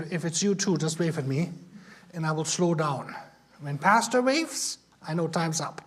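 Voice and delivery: ordinary talk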